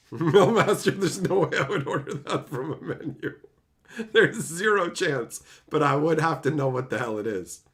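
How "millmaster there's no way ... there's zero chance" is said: laughing. Real, not acted. Laughing at a chat message.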